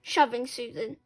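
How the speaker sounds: usual speaking voice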